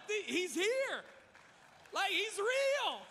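high, loud, slow and awed; he's really here, can you believe it; starstruck excitement. Retold or imitated. Pastor retelling meeting the Rib King, starstruck. Meeting Famous Dave, thrilled.

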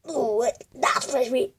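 ordinary talk

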